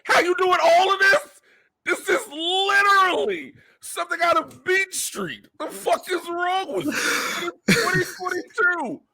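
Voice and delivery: high squealing exasperation; exasperated rant. Real, not acted. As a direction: rant in mock disbelief. Incredulous mock outrage.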